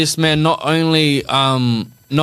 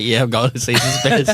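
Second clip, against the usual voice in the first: quoting himself; 4 semitones lower than usual. Retold or imitated. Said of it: "I was like".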